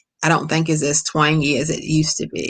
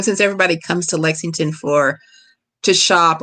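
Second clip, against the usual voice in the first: normal talking voice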